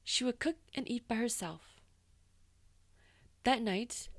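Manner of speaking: ordinary delivery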